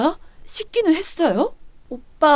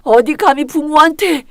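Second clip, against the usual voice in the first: ordinary delivery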